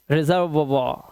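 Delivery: "रिजर्व" as REZ-er-vwaa, like reservoir; careful model of "रिजर्व" ending vwaa. Shown as a demonstration. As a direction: draw out a final waa on "रिजर्व". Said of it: "वा हो जाता है".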